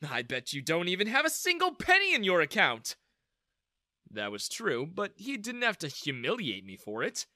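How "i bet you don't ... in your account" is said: high, laughing, scornful disbelief; laughing mockery. Daniel mocks the girl's claim, laughing. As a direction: laugh through the ridicule. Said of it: "Daniel started laughing".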